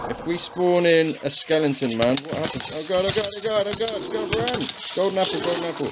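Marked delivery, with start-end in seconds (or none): 2.7-5.9 panicked high-pitched babbling; frantic rising oh god, then run; terrified gibbering. Real, not acted. Skeleton spawn, mobs attack.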